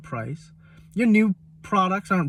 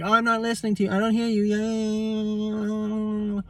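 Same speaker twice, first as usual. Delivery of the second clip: higher sing-song brat tuning someone out; childish dismissive mimicry; 3 semitones higher than usual. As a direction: imitate a child refusing to listen, drawing out the last words. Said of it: "like a child would".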